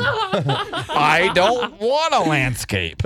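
slow, drawn-out mocking echo; exaggerated dopey whine of a copy; mimicking co-worker. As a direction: parrot the refusal in stretched words. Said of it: "I really don't wanna do landscaping".

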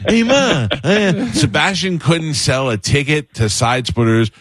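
jeering stage-comic imitation; mock Italian mom bit. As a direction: mimic a comedian calling to Ma. Mocks comedian's Italian mom jokes.

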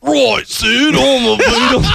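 high Liverpool Beatle voice; hammy high Scouse Beatle; high-pitched mock Liverpool accent; Beatle impression.

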